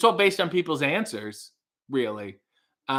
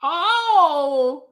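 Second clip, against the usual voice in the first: high, loud, held-out cry; Gene Stapleton impression; 13 semitones higher, 6 dB louder than usual. Vlogger showing viewers an impression, high loud holler. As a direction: draw the words out high and loud. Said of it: "That was, uh, Gene Stapleton".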